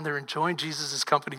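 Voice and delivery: normal talking voice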